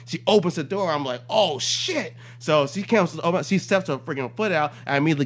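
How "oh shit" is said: startled. Retold or imitated. Cop startled as door opens.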